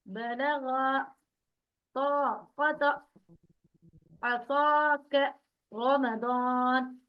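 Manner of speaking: रौन, क़ाल, बलग़, ताक़त recited with dark, rounded, stretched vowels; thick long alif. Shown as a demonstration. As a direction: hold the heavy "aw" in रौन, क़ाल and ताक़त, each word separate. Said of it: "तो मोटा पढ़ा जाएगा". (रौन, क़ाल, ताक़त को मोटा, खींचकर पढ़ें)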